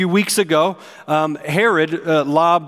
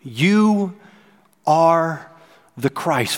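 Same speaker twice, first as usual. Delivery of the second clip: four words, each spaced apart; slow deliberate proclamation; much slower than usual. Shown as a demonstration. Peter answering Jesus, slow and weighty. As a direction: weigh every word. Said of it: "Four words".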